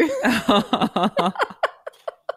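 drawn-out amused laugh. Real, not acted. Host laughs at a period on a fairground ride, amused. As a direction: let a laugh run on.

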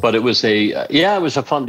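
ordinary talk

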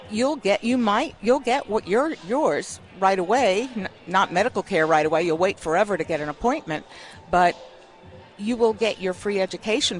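ordinary talk, nothing marked

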